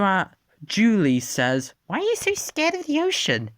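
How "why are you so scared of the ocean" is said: very high fast squeak; high quick voice. Acted. Julie questioning Dippy, high fast squeaky voice.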